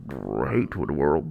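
deep slow gurgly voice; low slow stomach voice. Pretend. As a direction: voice a rumbling gut, slowly. Voicing the stomach.

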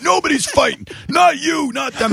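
high, firm fatherly shout; stern dad. Retold or imitated. To both sides of the fight, high stern dad shout. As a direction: lay down the law like a dad. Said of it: "I'm like a dad".

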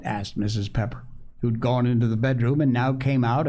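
plain reading voice

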